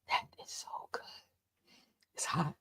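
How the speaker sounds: hushed aside while sipping coffee; whispering. Real, not acted. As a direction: whisper it under your breath.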